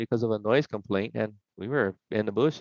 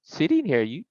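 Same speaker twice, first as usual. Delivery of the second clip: mock innocence; 6 semitones higher than usual. Retold innocent denial to cops.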